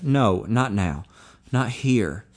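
plain reading voice

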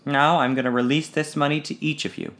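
ordinary delivery, nothing marked

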